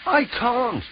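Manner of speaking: high, anxious protest; nervous high voice. Acted. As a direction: panicky little refusal. Percy panics at being chosen.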